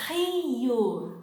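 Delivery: Rio with a throaty h-like R, like hee-oo; Portuguese R. Shown as a demonstration. Teacher modelling Rio for students to repeat.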